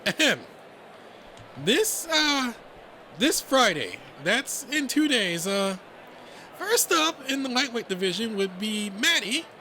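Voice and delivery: high, hesitant rabbit voice; halting, high-pitched announcement; flustered announcer. Acted. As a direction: fumble nervously through the list. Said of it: "he tries to hurriedly read through".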